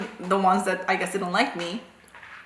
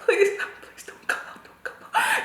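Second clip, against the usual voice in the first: high, hushed mock pleading; silent desperate prayer; 5 dB quieter than usual. Pupil praying she isn't wrong, high hushed desperate plea. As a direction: beg under your breath, squeaky. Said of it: "praying inside".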